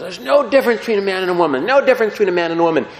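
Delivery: fast, pompous proclamation; hammed-up orator declaring "no difference"; mocking Voltaire. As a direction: rattle it off like a smug philosopher. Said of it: "He gets up and he goes".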